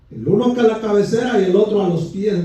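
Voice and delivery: normal talking voice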